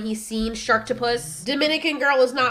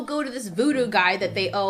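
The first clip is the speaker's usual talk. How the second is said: normal talking voice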